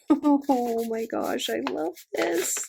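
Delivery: slow, high, drawn-out squeal; stretched-out squeal of delight; thrilled gushing. Real, not acted. Thrilled by washi tower.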